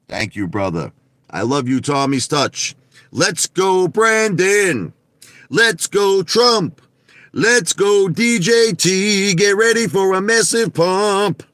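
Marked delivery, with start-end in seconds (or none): 3.1-11.4 high, loud rallying cry; pitched-up, punchy chant; hype cheer. Real, not acted. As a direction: whoop up the crowd with drawn-out cheers.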